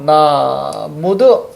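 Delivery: 나 drawn out, then 모두 sounded out; syllable-by-syllable demo. Shown as a demonstration. Teacher walks through the sound change, drawn-out demo of 나, 모두. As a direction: hold 나 long before saying 모두. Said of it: "음절의 끝소리 현상까지 가지면".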